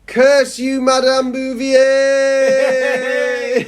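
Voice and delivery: high, long drawn-out mock cry; hammed-up villain's curse, last word stretched; melodramatic curse. Pupil cursing the French teacher, melodramatic.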